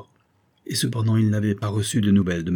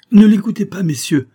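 loud, high-pitched outburst; alarmed plea, bursting out; urgent protest; 12 semitones higher, 10 dB louder than usual. A narrator's reading. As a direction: cut in to stop them believing it. Chemist urgently defends his assistant.

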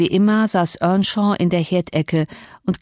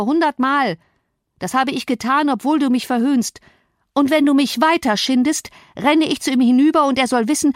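higher, heated self-defence; indignant cousin; 6 semitones higher than usual. Acted. Cousin defends himself against Cathy, indignant. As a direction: argue back, aggrieved and worked up.

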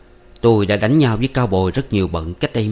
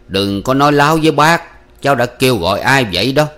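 louder, raised, pressing question; stern challenge; 4 semitones higher, 6 dB louder than usual. Acted. Uncle confronting the young man's lie, stern. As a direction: cut through the lie firmly.

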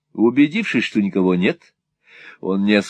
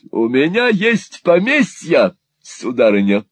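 slow, high, mildly grand boast; boastful old gentleman; 7 semitones higher, 4 dB louder than usual. A narrator's reading. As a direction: declare it grandly, unhurried.

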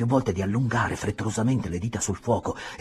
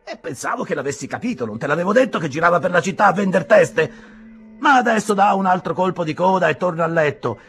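higher, louder amused reply; lightly voiced landlord; 9 semitones higher, 8 dB louder than usual. A hint of the landlord teasing his guest. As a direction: shrug it off, teasing.

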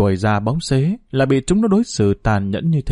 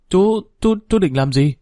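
flustered fear; 6 semitones higher than usual. A narrator's reading. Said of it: "Hà tái mặt lúng túng hỏi".

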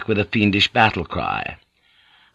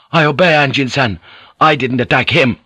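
raised, urgent self-defence; higher-pitched emphatic submission; protesting obedience; 4 semitones higher, 4 dB louder than usual. A narrator's reading. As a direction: protest innocence to a master. Ronin protests to his lord.

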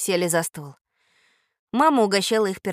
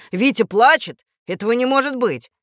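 louder indignant disbelief; a father protesting, voice raised; 5 semitones higher, 6 dB louder than usual. Acted. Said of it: "возмутился папа".

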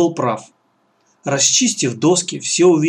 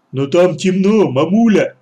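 put-on loud, higher childish protest; whining big son; 5 semitones higher, 5 dB louder than usual. A narrator's reading. Clumsy son balks at the dark cellar, whining. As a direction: protest loudly like an overgrown child.

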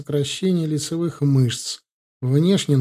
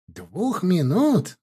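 slow, incredulous echo, pitched up; exclaimed; 5 semitones higher, much slower than usual. A narrator's reading. Law student echoes his friend's insult, exclaiming in surprise. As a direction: exclaim it as a drawn-out, higher question. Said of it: "воскликнул будущий юрист".

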